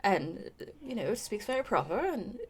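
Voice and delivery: proper accent with a slight lilt; soft, very proper mixed British accent; Finny. Shown as a demonstration. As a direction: speak properly, lightly lilting British-Isles accent. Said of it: "trying to do Finny".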